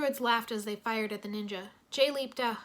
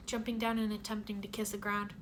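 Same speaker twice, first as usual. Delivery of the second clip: normal talking voice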